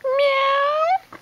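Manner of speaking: high rising meow; cat meow. Retold or imitated. Parent meows back to toddler, high kitten mew.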